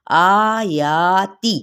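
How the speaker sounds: आयाती very slow, both long vowels stretched equally; equal stretch demo. Shown as a demonstration. Teacher shows students the even stretch in आयाती. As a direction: pull both vowels of आयाती to the same length. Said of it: "दोनों तरफ देखिए खड़ा ज़बर है".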